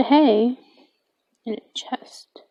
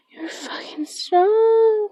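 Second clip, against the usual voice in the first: shocked whisper; 11 semitones higher, slower than usual. Real, not acted. Zombie overpowering the player.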